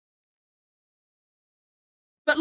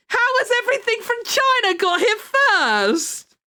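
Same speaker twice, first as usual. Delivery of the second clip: high-pitched incredulous wail; high, indignant complaint; exasperated disbelief; 10 semitones higher than usual. Real, not acted. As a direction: whine it in comic outrage. Mock outrage over late pencil delivery.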